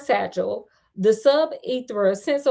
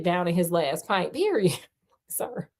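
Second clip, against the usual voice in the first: plain reading voice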